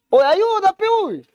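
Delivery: high pleading cry for help; hammed-up call for help; mock cry for help. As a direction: beg for help in a squeaky voice.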